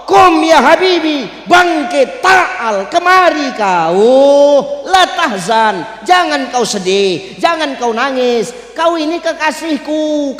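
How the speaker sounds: loud, high, slow proclamation; acted commanding yet consoling summons; God's booming answer. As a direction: call out grandly, stretching each phrase. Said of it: "Apa kata Allah?".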